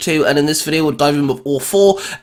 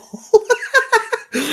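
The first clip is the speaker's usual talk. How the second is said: real burst of laughter. Real, not acted. Surprised laugh at unexpected idea.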